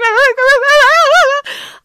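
long, high, loud fake bawling; mock crying. Friend puffy-faced and crying by the gate, exaggerated wail. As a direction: imitate a friend's wordless sobbing wail. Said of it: "she's crying. She's like".

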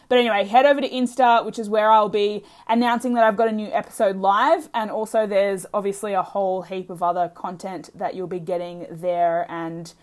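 ordinary delivery

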